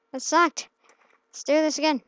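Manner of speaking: ordinary delivery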